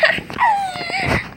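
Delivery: short, high cackling laugh; high mocking cackle. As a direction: cackle at him. Her laughter continues.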